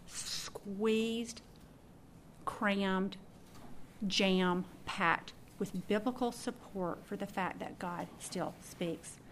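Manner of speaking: each word slowed down; slow emphasis. Real, not acted. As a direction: stretch it out for weight.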